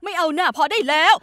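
a mother snapping loudly at her daughter; angry shout. Acted. Mother cuts daughter off angrily.

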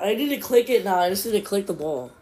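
ordinary delivery

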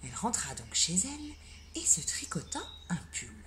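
hushed storytelling whisper; bedtime-story whisper; whispered narration. A narrator's reading.

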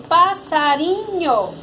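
"passarinho" syllable by syllable; sílabas separadas. Shown as a demonstration. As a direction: say "passarinho" in measured syllable pieces. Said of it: "Você vai juntar". ("passarinho" dito sílaba por sílaba)

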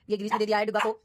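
usual speaking voice

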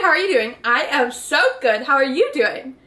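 ordinary delivery

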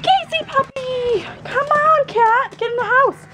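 high, drawn-out call to the pets; high-pitched nudge to hurry them inside; coaxing call. Real, not acted. Owner herding her dog and cat into the house, high coaxing call.

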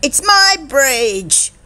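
high, slow storybook claim; triumphant cry. A narrator's reading. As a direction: claim the prize, drawn out and high. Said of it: "cried Posey".

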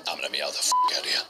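very low, very fast distorted cat voice; sped-up cat voice. Acted. Cat narrator announcing it will meow its way out. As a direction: growl it out low and rushed.

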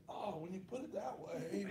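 small, high, soft voice; sheepish concession. Retold or imitated. Wife conceding to the women in her mom's group, sheepish.

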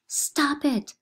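shrill screamed command, high; screaming. Acted. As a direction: scream it at the top of her lungs. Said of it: "screaming it out at the top of her lungs".

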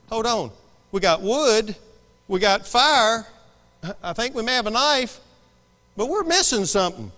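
puzzled son working it out aloud; lightly acted boy questioning Dad; lightly voiced Isaac. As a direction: build from hesitant query to loud, high question. Acts young Isaac questioning his father.

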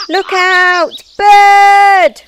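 high, very loud alarm cry; panicked shout. A narrator's reading. Snails warning of a bird overhead, panicked. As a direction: shriek a warning. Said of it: "cried the snails in panic".

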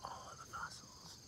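whisper. Real, not acted. Whispering at fossil gorge.

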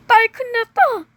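alarmed shout. Acted. Parent alarmed that the daughter is sick, panicked shout. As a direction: burst in with the bad news.

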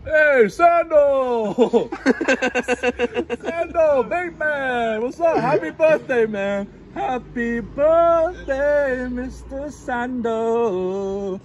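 loud, high, drawn-out birthday greeting; cheery boss greeting. Acted. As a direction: belt it out like an overjoyed boss.

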